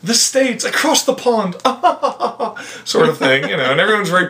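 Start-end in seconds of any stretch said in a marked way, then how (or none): 0.0-1.6 high-pitched English caricature; mock British accent. English stranger guessing the visitor is American, mock British accent. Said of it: "in England". 1.6-2.8 high put-on society laugh; mock English titter. English stranger amused by own remark, mock titter.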